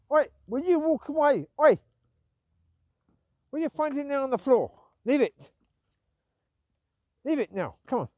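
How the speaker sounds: loud, high telling-off; scolding the dog. Real, not acted. Owner scolds her dog for eating floor rubbish, loud high telling-off.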